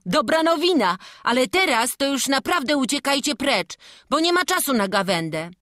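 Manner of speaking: bright eager lad speaking to the family; character voice. Acted. Young church helper inviting the family in, eager.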